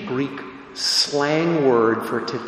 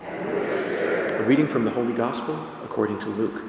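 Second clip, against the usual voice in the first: gospel reading; 3 semitones lower, 4 dB quieter than usual. Acted. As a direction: proclaim the Gospel reverently.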